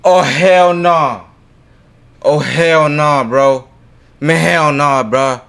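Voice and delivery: loud, high, drawn-out wail of protest; scared refusal. Real, not acted. Streamer reacting to creepy chanting, scared refusal. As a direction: stretch each word out in loud alarm. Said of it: "Damn, I got so scared".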